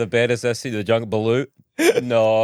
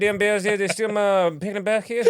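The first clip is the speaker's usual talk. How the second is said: higher, grumbling Cajun voice; Cajun accent; 5 semitones higher than usual. Acted. Camper accusing bears of stealing, Cajun accent. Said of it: "He's got a sort of Cajun accent".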